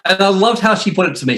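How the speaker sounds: ordinary delivery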